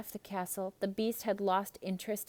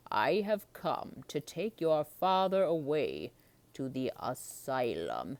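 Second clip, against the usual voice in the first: sinister man, lower and slow; slow, low stranger; 6 semitones lower, slower than usual. Acted. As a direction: menace them slowly. Strange man voiced in story.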